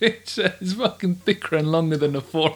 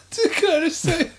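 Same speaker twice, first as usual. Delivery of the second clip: laughing high-pitched plea; barely holding it together; horrified amusement; 12 semitones higher than usual. Real, not acted. Laughing fit.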